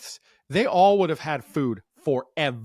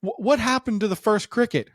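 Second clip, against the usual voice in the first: sweet probing dad; 8 semitones higher than usual. Retold or imitated. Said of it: "So I go".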